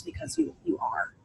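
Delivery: whispered reassurance to women listening; soft emphatic ending. Real, not acted. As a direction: drop almost to a whisper on you are. Closing encouragement to listeners.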